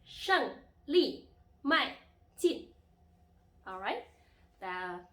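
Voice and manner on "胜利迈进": 胜利迈进 loud and high, each syllable falling sharply; emphatic falling-tone drill on 胜利迈进; fourth-tone demo. Shown as a demonstration. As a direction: punch out 胜利迈进 slowly, one falling syllable at a time. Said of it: "these are the four tones".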